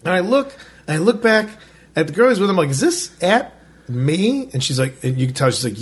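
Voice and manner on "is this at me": slow, higher, mock-incredulous asking; incredulous question. Asking the angry man's girlfriend, incredulous. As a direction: stretch it out in retold disbelief. Retelling his reaction to being sworn at.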